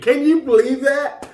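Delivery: high, incredulous "Can you believe that?"; disbelief. Real, not acted. Vlogger reacts to KD naming Serge Ibaka, disbelieving. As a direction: pitch it way up in disbelief.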